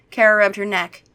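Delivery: ordinary delivery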